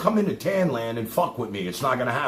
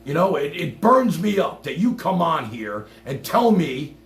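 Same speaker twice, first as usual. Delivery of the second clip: ordinary talk, nothing marked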